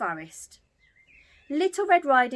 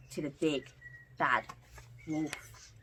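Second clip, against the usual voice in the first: hushed; 7 semitones lower, 8 dB quieter than usual. A narrator's reading. Ominous reveal of the wolf.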